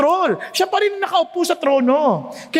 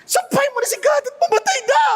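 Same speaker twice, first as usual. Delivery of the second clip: high, fast furious shout; wife shouting at Job in rage; angry wife shouting; 10 semitones higher, faster than usual. Retold or imitated. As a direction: yell at him to curse God and die. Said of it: "Kasi galit ni asawa eh".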